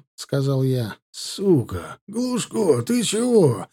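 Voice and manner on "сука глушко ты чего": alarmed, high-pitched call; startled shout held back in volume; sudden cry. A narrator's reading. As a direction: cry out to a hurt comrade, without full volume. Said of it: "вдруг крикнул за моей спиной Наливкин".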